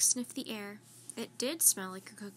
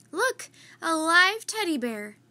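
put-on higher, louder, slow childlike delight; delighted discovery; 5 semitones higher, 10 dB louder than usual. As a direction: point and gush over something adorable. Annie spots a koala.

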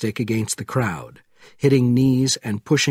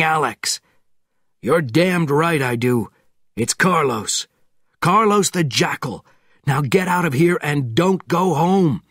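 raised, pressing voice; urgent commands; 6 semitones higher, 3 dB louder than usual. A narrator's reading. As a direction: push him to hurry.

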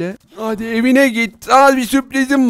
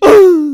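loud, high gasp of fright; exaggerated startled yelp; frightened gasp; 8 semitones higher, 7 dB louder than usual. Sees the chainsaw.

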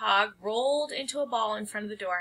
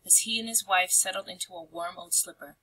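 ordinary delivery, nothing marked

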